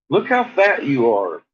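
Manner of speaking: normal talking voice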